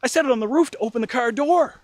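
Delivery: high, loud, stammering panic; imitating a brother's dawning shock. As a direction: stammer out a horrified confession, voice shooting up. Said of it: "The look of shock".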